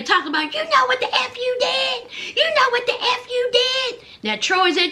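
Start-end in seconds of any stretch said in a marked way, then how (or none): 0.5-4.0 high, angry accusing yell; screaming accusation. Retold or imitated. Wife accusing husband on camera, angrily high. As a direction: hurl the accusation at him. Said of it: "Z is still screaming".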